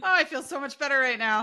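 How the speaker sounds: giggling it out, pitch up and bright with laughter; laughing as the tension lets go; laughing relief. Real, not acted. Co-host laughing with relief after venting about husband.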